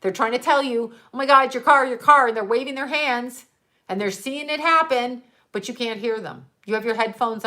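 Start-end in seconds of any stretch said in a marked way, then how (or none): 1.1-2.3 louder, mock frantic warning; half-yelled urgent warning; retold warning scream. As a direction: call out as if warning someone across a street. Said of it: "trying to scream at you".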